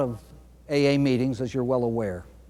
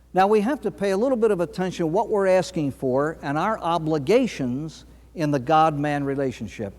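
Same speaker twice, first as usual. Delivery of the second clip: usual speaking voice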